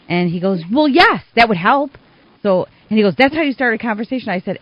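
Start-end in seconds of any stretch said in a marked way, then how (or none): 0.7-1.9 high, loud mock exasperation; retold irate retort. Rink contact answering whether he wants her name, irate. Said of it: "he starts yelling". 3.2-4.3 retold yelling. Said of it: "he starts yelling".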